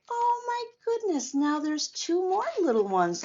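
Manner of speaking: high, bright burst of delight; delighted exclamation. Real, not acted. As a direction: gasp it out with real joy. Delighted at new grandchildren.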